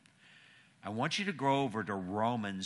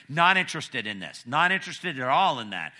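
loud, raised-pitch grandma's rebuff; emphatic refusal; 5 semitones higher, 11 dB louder than usual. Retold or imitated. Grandma turning down her granddaughter's invitation, emphatic refusal. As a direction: shut the request down forcefully, as retold.

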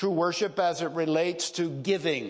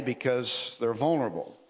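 normal talking voice

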